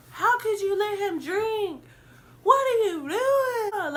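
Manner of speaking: high, whiny mock outrage; mocking whiny women. Narrator mocking the vodka women's fake outrage, high and whiny. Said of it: "these two bitches with the vodka were like".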